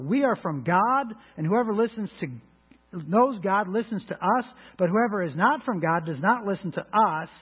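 scripture reading. Acted. Rereading the verse.

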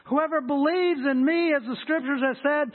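proclaiming high and loud, slowing on the drink line; preacher voicing Jesus crying out loud at the feast. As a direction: call out to the whole crowd. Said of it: "said in a loud voice".

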